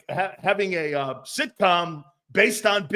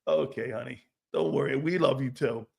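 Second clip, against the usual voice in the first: put-on softer, lower, coddling a sulking child; mock soothing; 5 semitones lower, 6 dB quieter than usual. Fans soothing a jealous star, mock tender. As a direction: pat him on the head with your voice. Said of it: "And you're like".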